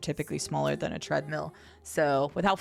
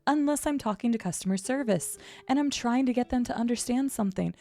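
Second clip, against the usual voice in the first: higher, lilting nice service-desk voice; customer service voice; 5 semitones higher than usual. Shown as a demonstration. Streamer shows the voice for customer service, high and nice. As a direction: put on a nice, falsely genuine customer service lilt. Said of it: "the nice customer service voice".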